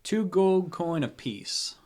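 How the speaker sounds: name the price, drawn out; slow deliberate price. Acted. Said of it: "And he goes".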